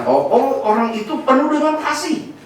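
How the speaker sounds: high-pitched gushing praise; mock admiration. Onlooker praising a man as full of love, high admiring voice.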